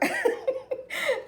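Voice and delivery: high-pitched laugh; light, high burst of laughter; high giggle. Real, not acted. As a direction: giggle up high at your own remark. Laughs after asking about December.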